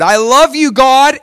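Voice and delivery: worshipper at the altar cries out to God, high loud mock-fervent praise; loud, high-pitched acted adoration; exuberant worship. Worshipper bringing a gift to the Lord, exuberant acted praise.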